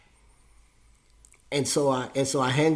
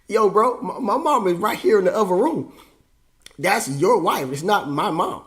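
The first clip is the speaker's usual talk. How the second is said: raised, higher-pitched protest in disbelief; indignant protest; 5 semitones higher, 4 dB louder than usual. Acted. Son setting dad straight about his mom, indignant. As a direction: push back hard, as if correcting an absurd claim.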